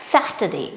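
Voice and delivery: teacher's model SAT-er-day; correct Saturday. Shown as a demonstration. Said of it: "वो है".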